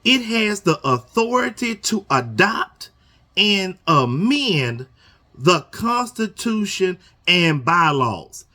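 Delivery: slow, stretched reading of the bylaw. Shown as a demonstration. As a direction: read each word out slowly. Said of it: "I'ma read this slow".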